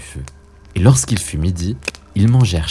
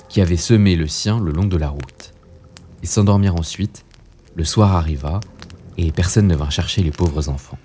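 ordinary delivery, nothing marked

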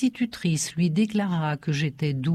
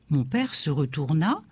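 usual speaking voice

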